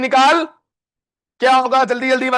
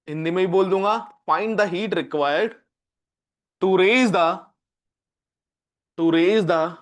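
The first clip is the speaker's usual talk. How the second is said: slow, lower, word by word with pauses; slow dictation; 6 semitones lower, 4 dB quieter, much slower than usual. Shown as a demonstration. Teacher dictating a physics question to the class, slow and drawn out. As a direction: read it out slowly so students can write it down.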